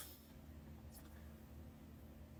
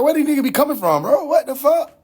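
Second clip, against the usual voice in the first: fast, high-pitched disbelief; exasperated outburst; 7 semitones higher than usual. Retold or imitated. Streamer voicing his own reaction to lookalikes, exasperated and baffled. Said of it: "I'm like".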